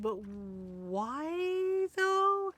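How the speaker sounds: drawn-out, rising puzzled plea; mock bewildered. Pressing Ron on why he dated her, bewildered. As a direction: stretch it out slowly, pitch climbing high. Retold questioning of friend's choice.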